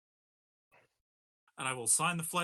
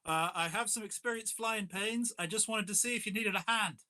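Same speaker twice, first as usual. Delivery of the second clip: higher, put-on British action star; Jason Statham voice; 7 semitones higher than usual. Action star offering help through the cockpit door, put-on British accent. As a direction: play the polite movie star knocking at the cockpit. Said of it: "This is British actor Jason Statham out here".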